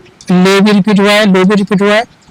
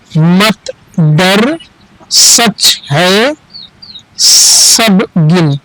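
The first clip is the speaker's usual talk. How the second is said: put-on slow word-by-word reading for a child; patient teacher pace, one word at a time; slow reading drill; much slower than usual. Shown as a demonstration. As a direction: read each word slowly and clearly, with pauses. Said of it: "अब एक बार हम repeat कर लेते हैं".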